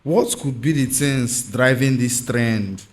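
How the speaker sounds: ordinary delivery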